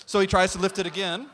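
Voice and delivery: usual speaking voice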